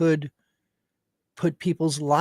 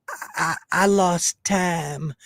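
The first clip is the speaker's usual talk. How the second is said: slow, halting, faraway innocent voice; mock stammering innocence; 3 semitones higher, slower than usual. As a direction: stretch out the stammer like a fake amnesiac. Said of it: "He's like".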